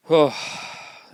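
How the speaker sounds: long heavy sigh; loud sigh. Real, not acted. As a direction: let out a big sigh. Sigh after losing his voice.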